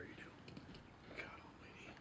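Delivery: breathy whispered exclamation, barely voiced. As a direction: whisper it under your breath in dismay. Muttered exasperation after dreaded scene.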